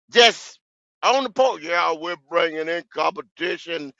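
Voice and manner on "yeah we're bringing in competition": soft, low mock of a team boss; mocking Dan Morgan. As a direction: hush it like a smug exec fibbing. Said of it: "That was Dan Morgan".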